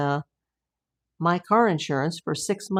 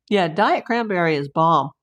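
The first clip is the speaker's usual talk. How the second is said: ordinary delivery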